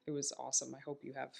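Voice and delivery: ordinary talk, nothing marked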